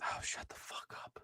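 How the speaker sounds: whispered insult under breath; hushed whisper. Real, not acted. Muttered reply during the Sox rant.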